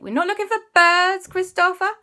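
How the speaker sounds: high, loud exasperated telling-off; exasperated scolding. Acted. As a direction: scold a child who is missing the point. Exasperated adult scolds child in story.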